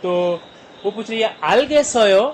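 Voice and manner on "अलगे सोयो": a touch of a teacher's question, higher and louder; teacher asking. Shown as a demonstration. Teacher asking students if they understood, lightly voiced. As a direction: ask the class like a teacher.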